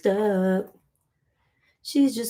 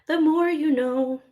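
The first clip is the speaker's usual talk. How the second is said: pitched-up jingle-style sign-off, higher; mock PSA tagline; 7 semitones higher than usual. Telling her viewers, a mock PSA sign-off. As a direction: sell it like a public service slogan. Jokey tagline after vowel lesson.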